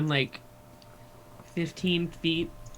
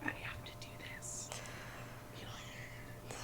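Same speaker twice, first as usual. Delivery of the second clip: whisper; 12 dB quieter than usual. Acted. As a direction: hush it, barely audible. Whispering while invisible.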